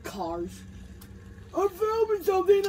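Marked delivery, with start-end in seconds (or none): 1.6-2.7 higher, louder call to someone nearby; annoyed call to a neighbour. Real, not acted. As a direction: shout it across to Chuck. Calling out to Chuck.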